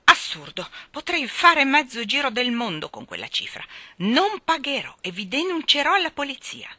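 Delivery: loud, high, outraged protest; deafening voice. A narrator's reading. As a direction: rage loudly at being overcharged. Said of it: "li aveva quasi assordati". (protesta assordante e indignata)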